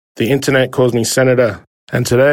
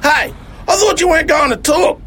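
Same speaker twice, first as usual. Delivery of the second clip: higher, exasperated character shout; mock scold. Driver scolding Elvis for talking, a hammed-up shout. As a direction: snap at the kid for breaking his promise.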